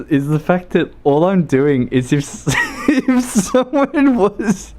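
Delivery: high, laughing through the words; real laughter. Real, not acted. Streamer cannot finish the story, helplessly laughing. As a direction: struggle to talk as giggles take over.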